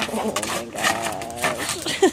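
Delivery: very slow low oh my gosh; amused disbelief. Real, not acted. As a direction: draw it out in disbelief. Dog does something silly.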